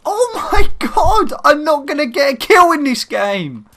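high, loud wail of frustration; exasperated outcry. Real, not acted. Gamer despairing of getting a kill, exasperated outcry. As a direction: wail at the game, voice way up.

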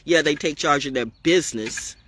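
ordinary talk, nothing marked

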